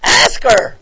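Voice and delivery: put-on loud, high, demanding child; imitated kid's high, loud command; little daughter. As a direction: order dad about like an insistent little girl. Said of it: "She comes over to me".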